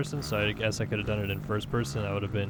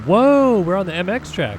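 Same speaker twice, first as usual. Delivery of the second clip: sudden loud, high whoa; surprised whoa; 7 semitones higher, 9 dB louder than usual. Real, not acted. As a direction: caught off guard, let it burst out. Surprised at reaching MX track.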